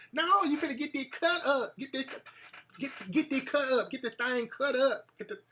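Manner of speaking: lightly voiced country girlfriend; country girl voice. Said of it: "talk that country fucking shit".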